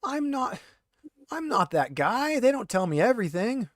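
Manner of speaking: a hint of a rattled, defensive prisoner pleading innocence; lightly voiced handcuffed thug, indignant then wheedling. GM as the interrogated prisoner, lightly voiced and defensive.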